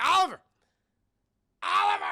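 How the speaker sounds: high, loud yell for help; calling out. Retold or imitated. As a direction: shout his name while falling. Madea falling out of the car.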